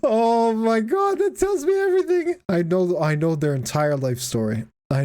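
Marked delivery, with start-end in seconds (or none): 0.0-2.4 amused excitement. Real, not acted. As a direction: savor the discovery. Amused by car.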